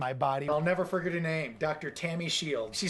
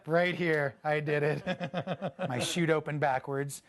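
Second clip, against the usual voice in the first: ordinary talk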